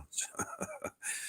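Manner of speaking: a short, voiceless burst of laughter; breathy laugh. Real, not acted. Laughs after admitting drunk exaggeration.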